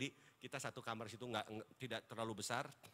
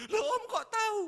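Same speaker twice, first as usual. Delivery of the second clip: hammed-up teen boy asking between sobs; crying teenage nephew; 13 dB louder than usual. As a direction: mimic the startled weeping nephew. Nephew voiced crying in sermon story.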